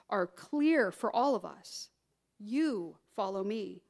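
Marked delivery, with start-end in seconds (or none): 2.4-3.8 long "You", then a drop on "follow me"; slow, pointed "You", held apart; single out the listener with a stretched "You"; stress on YOU. Acted. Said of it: "emphasize the word "you".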